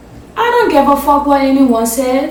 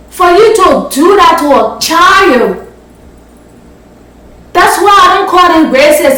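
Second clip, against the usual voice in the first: loud, raised, indignant; angry rant; 3 semitones higher, 4 dB louder than usual. Real, not acted. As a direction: hammer the point home, louder. Angry rant about cruelty to children.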